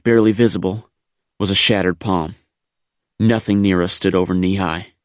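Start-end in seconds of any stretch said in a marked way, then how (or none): none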